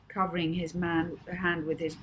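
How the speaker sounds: ordinary delivery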